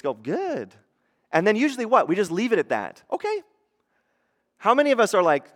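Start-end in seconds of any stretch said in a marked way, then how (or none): 3.1-3.4 breezy acceptance. Acted. Acted acceptance of stock reply.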